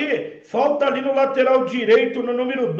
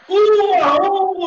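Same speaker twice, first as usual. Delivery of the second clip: excited score call; 6 semitones higher, slower than usual. Acted. Announcing score loudly.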